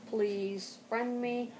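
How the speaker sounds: ordinary talk, nothing marked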